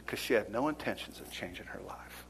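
soft whispered aside. Acted. Preacher hushing the point to the church, whispered. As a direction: let it fall to a whisper.

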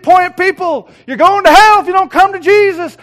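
high, full-voiced imitation of a street preacher; loud street preaching. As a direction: holler it at passers-by. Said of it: "he'd preach loud like this".

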